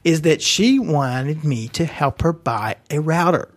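ordinary talk, nothing marked

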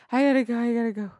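croaky, rushed sick voice; hoarse voice. Retold or imitated. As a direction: rasp it out, hurried and sick. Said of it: "he totally lost his voice".

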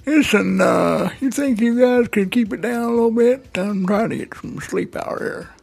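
put-on old grandpa voice. Grandpa wants quiet.

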